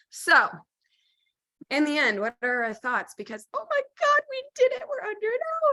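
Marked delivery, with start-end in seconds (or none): 3.5-5.7 very high, excited squeal of triumph; thrilled. Real, not acted. To her co-host, high excited squeal of triumph. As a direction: celebrate beating the clock. Excited at finishing under an hour.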